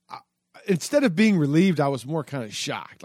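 normal talking voice

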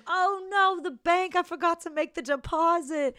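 high, loud panicked aunt voice; a high fluster of sudden forgetting. Retold or imitated. Aunt caught out at the door, high panicked fluster. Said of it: "And she goes".

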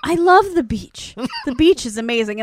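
retold druggy delight; tripping enthusiasm. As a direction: gush as if tripping. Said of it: "she's clearly tripping".

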